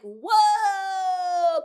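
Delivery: loud, high drawn-out whoa; shocked whoa. Retold or imitated. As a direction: act floored by what she just heard. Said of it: "Clearly I woke up now".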